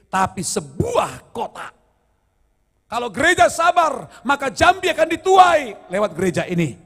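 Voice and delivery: rally the church with full voice; preaching shout. Real, not acted. Pastor rallies the congregation about the city, shouting.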